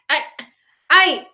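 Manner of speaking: high, broken stammer; voice cracking. A narrator's reading. Brother chokes up about their missing father, voice cracking. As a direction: let the voice break on it. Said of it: "My brother's voice cracks".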